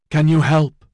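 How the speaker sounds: high, loud desperate plea; a falling man pleading loudly for help. A narrator's reading. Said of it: "he pleaded".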